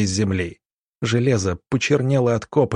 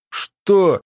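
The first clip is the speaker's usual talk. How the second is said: higher, louder startled "what"; startled gasped question; 8 semitones higher, 4 dB louder than usual. A narrator's reading. Glushakov caught off guard by the blast, startled. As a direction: blurt it out in shock. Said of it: "только и успел выдохнуть".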